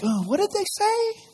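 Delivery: hammed-up jealous king; jealous surprise. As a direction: Saul can't believe his ears. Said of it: "And Saul's like".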